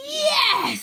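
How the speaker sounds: high, loud delighted scream; scream of joy. A narrator's reading. Wyn screaming with joy as the dragon dives. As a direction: shriek it in triumph. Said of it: "screams Wyn".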